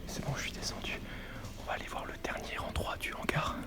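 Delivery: sneaking through a warehouse whisper; hushed, careful exploring voice. Real, not acted.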